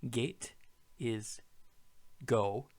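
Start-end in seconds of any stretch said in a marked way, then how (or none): none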